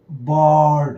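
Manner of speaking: बर्ड with a long, held "aw-uh" vowel; lengthened vowel. Shown as a demonstration. Teacher showing the ir sound in an example, drawn-out pronunciation. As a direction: stretch the middle of बर्ड evenly. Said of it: "हल्का सा बड़ा हो जाता है".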